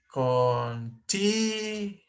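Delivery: "Continuity" slowly, with a long high TI; stress on second syllable. Shown as a demonstration. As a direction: sound out con-TIII-nuity syllable by syllable. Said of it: "I think it's the second".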